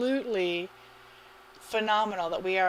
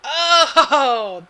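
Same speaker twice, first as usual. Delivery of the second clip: big loud, high laughing "oh"; delighted laughing; 6 semitones higher, 14 dB louder than usual. Real, not acted. Caster sees the message left in there, delighted laughing.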